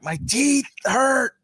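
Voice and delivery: high, slow whiny voice; whiny complaint. Retold or imitated. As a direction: whine it in a squeaky, drawn-out voice. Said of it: "you're like".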